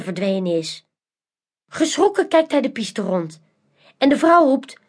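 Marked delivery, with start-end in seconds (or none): none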